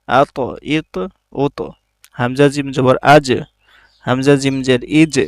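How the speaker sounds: the final দাল, ত, জিম of আদ, আত, আজ pushed out with a small echo; bounced qalqala letters. Shown as a demonstration. Teacher drilling qalqala on আদ, আত, আজ for students. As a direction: bounce the stopped letter at each syllable end. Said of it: "এটাকে ধাক্কা দিয়ে পড়তে হবে".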